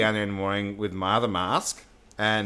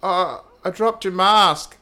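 imitating the son; 7 semitones higher, 5 dB louder, much slower than usual. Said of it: "He goes".

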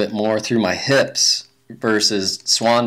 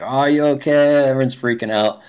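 imitated radio caller; 5 semitones higher, 3 dB louder than usual. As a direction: mimic a worried call over the radio. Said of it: "but the radio was like".

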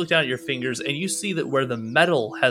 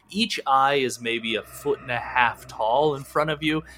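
ordinary talk

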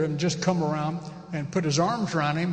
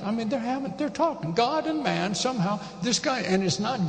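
ordinary talk

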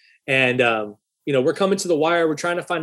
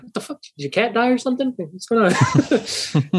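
higher, incredulous at the gloomy mood; baffled; 7 semitones higher than usual. Retold or imitated. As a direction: ask what's wrong, half laughing. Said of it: "I'm looking at him like".

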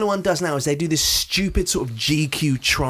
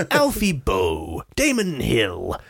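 slow, drawn-out interviewer voice; Michael Parkinson; slower than usual. Acted. Practising the interviewer impression on guests' names, slow. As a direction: stretch each name like a chat-show host. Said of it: "Michael Parkinson".

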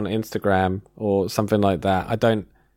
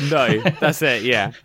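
laughing; 3 semitones higher than usual. Real, not acted. Sharing laugh.

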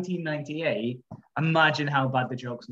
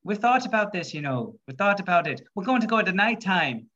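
put-on Irish accent. Two Irishmen reassuring Paddy, put-on Irish accent. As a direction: play the joke's Irishman, putting on the accent. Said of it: "the other two Irishmen go".

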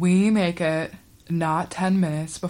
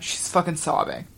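ordinary talk

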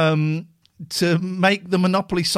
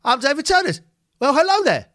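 higher, louder mock host intro; imitating David Turner; 10 semitones higher, 4 dB louder, faster than usual. Host mimicking David Turner opening the show, hammed-up. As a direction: ham up a dithering game show host. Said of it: "I just wanna go".

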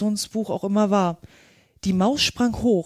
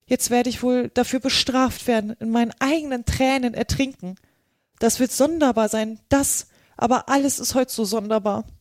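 raised, lamenting Alice voice; lightly higher voice, bemoaning her predicament; fretting Alice; 4 semitones higher than usual. Acted. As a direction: fret aloud as storybook Alice. Alice's voice in story.

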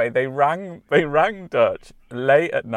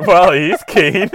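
slow, louder, wry repeat through a laugh; dry quote; 3 semitones higher, 9 dB louder, slower than usual. Retold or imitated. Repeating the instructor's line to her co-host, slow and wry. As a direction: drawl the punchline again for effect. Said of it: "she went".

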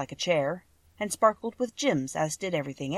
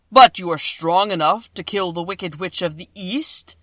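voice of the great Oz; 9 dB louder than usual. A narrator's reading. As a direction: boom each word out like a huge magic head.

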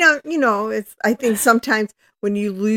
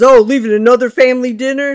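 loud, higher mocking sneer at leaving again; dad's sarcastic dig at his leaving daughter, louder; sarcastic jab; 5 semitones higher, 9 dB louder than usual. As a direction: throw the line back at her with scorn. Dad mocking her leaving.

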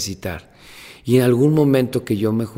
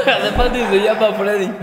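high, loud, laughing through the words; giggling agreement; 11 semitones higher, 6 dB louder than usual. Real, not acted. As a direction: speak while cracking up. Guest laughing at the name mix-up.

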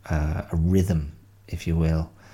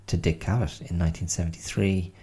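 ordinary talk, nothing marked

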